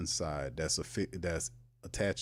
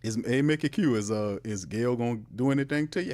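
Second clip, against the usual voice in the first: ordinary talk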